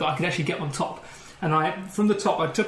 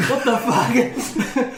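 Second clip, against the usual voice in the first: laughing quote; 5 semitones higher, 4 dB louder than usual. Retold or imitated. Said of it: "and she goes".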